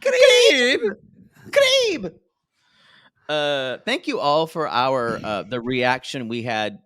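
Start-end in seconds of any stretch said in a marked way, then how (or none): 0.0-2.1 very high, loud mock squawk; imitating Tater. Host mimics Tater demanding the crib, high loud mock squawk. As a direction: squeal the single word in caricature.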